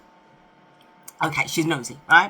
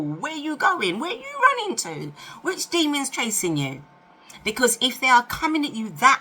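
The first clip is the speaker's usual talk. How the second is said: incredulous; 7 semitones higher than usual. Real, not acted. Mocking a too keen date.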